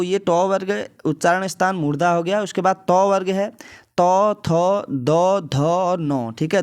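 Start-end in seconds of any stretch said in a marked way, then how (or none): 4.0-6.3 तौ, थ, द, ध, नौ with the tongue on the teeth; reciting dental letters. Shown as a demonstration. Teacher demonstrating the dental letters. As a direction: sound each one against the teeth. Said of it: "तो ये कहाँ से हो रहे हैं".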